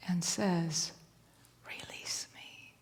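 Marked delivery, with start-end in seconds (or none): 1.7-2.7 soft slow whispered plea; whispering fish. Acted. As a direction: breathe the plea like a dying fish. Magic fish pleads with man.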